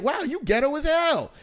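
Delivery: high, loud amazed remark. Retold or imitated. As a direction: gasp it out, teasing disbelief. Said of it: "And I'm like".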